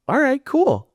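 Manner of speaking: very high, chirpy acceptance; mock eagerness. Comedian accepting a fan's drink offer, mock eager. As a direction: squeak out an overly agreeable reply.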